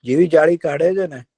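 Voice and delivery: usual speaking voice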